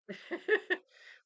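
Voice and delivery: high, loud laugh bursting out; burst of laughter. Real, not acted. Laughing at Tuesday's mishap.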